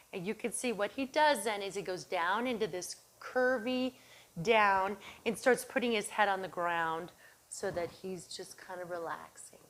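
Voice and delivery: normal talking voice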